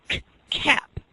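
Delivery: "k" whispered apart from "cap"; whispered k sound. Shown as a demonstration. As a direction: say "k" as a breathy unvoiced k, then "cap". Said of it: "the students say".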